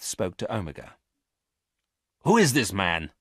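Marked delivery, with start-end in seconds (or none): 2.2-3.1 sharp demanding question; sharp order. Acted. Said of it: "he ordered sharply".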